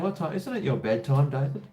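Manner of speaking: normal talking voice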